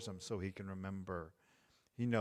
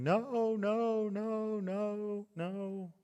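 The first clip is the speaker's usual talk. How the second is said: put-on much higher, a small boy's wailed no; imitating upset toddler; 12 semitones higher, 3 dB louder, much slower than usual. Toddler son pushing away from dad: higher wailing no. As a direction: mimic the two-year-old refusing, fading off.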